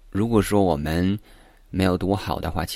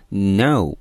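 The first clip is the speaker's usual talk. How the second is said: "no" said louder with full weight; stress on no; 4 dB louder than usual. Shown as a demonstration. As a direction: hit "no" hard and clearly. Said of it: "明显是读得比较的重". (重读 no)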